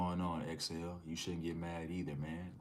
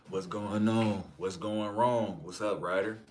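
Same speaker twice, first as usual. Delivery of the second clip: loud, higher hyped-up greeting; hyped shout; 3 semitones higher, 10 dB louder than usual. Real, not acted. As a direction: shout it out to the whole chat.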